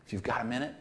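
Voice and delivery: a shy request that barely dares; timid polite ask. Retold or imitated. Preacher mocks a meek blind man, softly and politely. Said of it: "It's not like".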